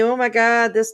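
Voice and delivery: ordinary talk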